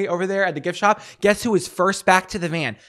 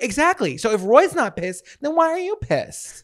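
pitched up, exasperated comeback; indignant challenge; 8 semitones higher than usual. Retold or imitated. Latecomer challenging annoyed passengers, indignant.

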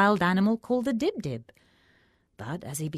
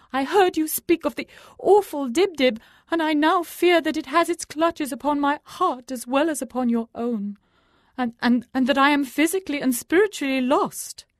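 higher, louder trembling confession of a scared holy man; faltering frightened sage; 11 semitones higher, 8 dB louder than usual. A narrator's reading. Terrified Faki confesses to the crone, faltering plea. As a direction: stumble through your fear of being cursed. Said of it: "faltered the learned one".

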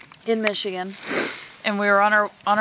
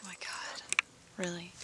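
whisper; 15 dB quieter than usual. Real, not acted. Annoyed at the disruption.

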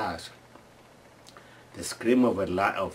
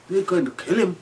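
put-on alarmed kids' cry; 8 semitones higher than usual. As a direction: call it out in childish alarm. Kids watching man chase elephants.

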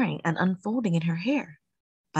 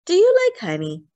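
put-on excited child; 11 semitones higher, 6 dB louder than usual. A narrator's reading. Child with new idea.

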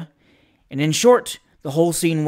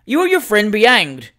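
loud, higher dismissal; contemptuous retort; 5 semitones higher, 6 dB louder than usual. A narrator's reading. The waistcoat man loudly dismisses the young men. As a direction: fling it back with contempt. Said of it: "responded the waistcoat".

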